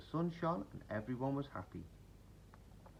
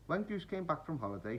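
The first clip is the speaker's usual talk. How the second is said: ordinary delivery, nothing marked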